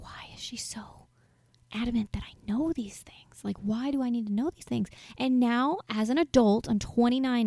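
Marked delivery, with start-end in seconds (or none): 0.0-4.9 breathy, voiceless private question to yourself; hushed bewildered whisper to yourself; whispered inner thought. Retold or imitated. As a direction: whisper it as if she mustn't hear.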